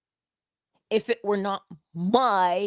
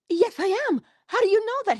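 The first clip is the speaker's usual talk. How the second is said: surprised; 13 semitones higher, faster than usual. Retold or imitated. Surprised reply to stranger.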